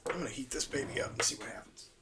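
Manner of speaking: fast, breathy whisper to himself. Real, not acted. Repairer whispering to himself before heating the part, a quick whisper. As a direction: whisper it like a secret plan.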